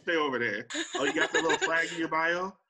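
loud, dismissive mock taunt through laughter; laughing mockery. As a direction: laugh them off as a know-nothing outsider.

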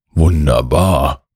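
slow, weary gnome delight; tired delight. A narrator's reading. Gnome offered the dream marble, tired delight. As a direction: draw it out, tired but pleased. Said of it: "strahlt der Waldschrat dich an".

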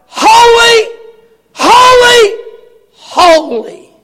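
high, loud shout falling away; shouted holy. Acted. Seraphs crying out, high ringing shout. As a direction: belt it out, then drop. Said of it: "They're crying out".

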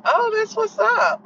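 high, slow drawn-out "Oh" that brushes it off; unimpressed reply. Retold or imitated. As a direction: toss it off slowly from up high, unbothered. Other student's casual reply.

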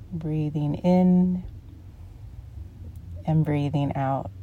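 calm meditation guide with long pauses; unhurried, soothing guided-relaxation voice. Real, not acted. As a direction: ease them into relaxation, phrase by phrase.

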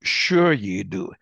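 low, slow, dry sarcasm; deadpan it, not buying it; sarcastic sure you do. Real, not acted. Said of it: "We value our people".

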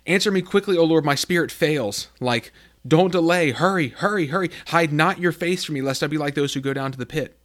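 frantic prayer for help, hurry hurry; desperate plea. Acted. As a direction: cry out to God like you're drowning. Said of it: "you feel like".

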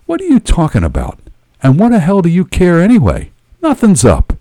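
high-pitched irritated retort; snapped. A narrator's reading. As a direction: snap back defensively. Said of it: "I, I snapped".

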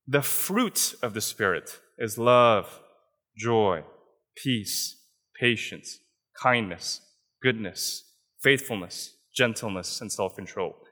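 slow scripture recitation fading down; scripture reading. Acted. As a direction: read each fruit slowly and gently, one by one. Reading verse twenty-two aloud.

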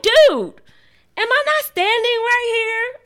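high, loud exasperated dude; exasperated scolding. Retold or imitated. Mom calling out her cursing son, high and exasperated. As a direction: scold him with mock outrage.

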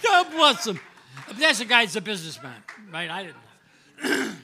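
laughing loudly and high through the words. Real, not acted. Player recalling the owner's generous offer, laughing. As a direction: burst out laughing as you say it.

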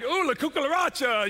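high, loud mock-Spanish exclamation; hammed-up high-pitched outcry; imitated startled man. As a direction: yelp it like the bewildered man being hugged. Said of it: "That guy's like".

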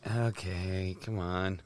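low, quiet resigned aside; quietly unconvinced, voice dropped; deflated reaction. Retold or imitated. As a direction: play it under your breath, let down. Retells his own deflated reaction.

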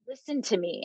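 soft insistence. Retold or imitated. Spirit Brenda insisting.

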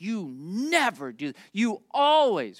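slow imitation of a worked-up kid; mock emotional accusation. Kids arguing when they're emotional, mock accusation. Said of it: "language of never and always when they're emotional".

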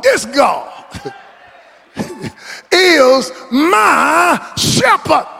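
slow climbing preacher's cry, breaking on shepherd; preaching at full force. Acted. Preacher building the psalm to a tearful shout at the church. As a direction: build it line by line, then cry it out.

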